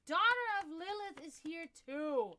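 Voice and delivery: high, loud, drawn-out call; real, big welcoming shout-out; excited greeting. Real, not acted. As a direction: announce a new arrival with delight. Calling out greeting to arriving viewer.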